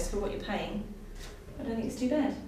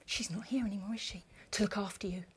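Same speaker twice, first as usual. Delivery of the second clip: cold and quiet; 4 semitones lower, 9 dB quieter than usual. Acted. Cold jab about absent mother.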